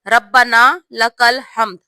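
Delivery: ربنا لک الحمد said slowly with a doubled b in ربنا; slow correct pronunciation. Shown as a demonstration. Teacher showing the correct pronunciation, slow and clear. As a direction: give ربنا لک الحمد full, measured syllables. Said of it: "صحیح تلفظ یہ ہے".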